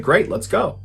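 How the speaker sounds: a touch of youthful excitement; excited. Retold or imitated. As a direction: sound keen to go along. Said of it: "was very excited about this and said".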